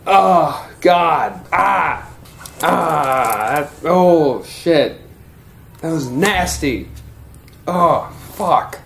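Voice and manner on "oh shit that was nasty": usual speaking voice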